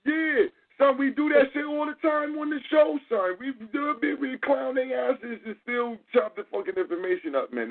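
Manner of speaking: hype, pushing back hard; excited. Real, not acted. Fired-up host insisting they do it on the show.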